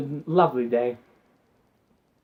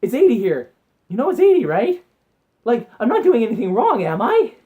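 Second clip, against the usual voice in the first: higher, innocent protest; innocent pleading; 5 semitones higher, 4 dB louder than usual. Retold or imitated. To the driver ahead, mock-innocent pleading. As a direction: plead your innocence, wide-eyed. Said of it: "I was literally saying".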